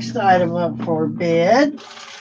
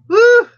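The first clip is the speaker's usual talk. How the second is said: loud cheering woo; 14 semitones higher, 9 dB louder than usual. Real, not acted. Cheering a won bid.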